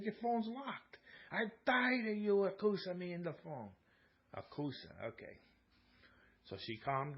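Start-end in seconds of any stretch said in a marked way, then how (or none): 0.0-3.7 higher, hurt protest in the wife's voice; pleading protest as the wife; imitating wife. Wife explaining to husband why she smashed it, higher protest.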